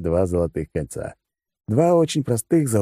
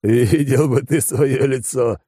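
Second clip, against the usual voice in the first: laughing; 6 semitones higher, 3 dB louder, much slower than usual. A narrator's reading. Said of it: "в приступе смеха".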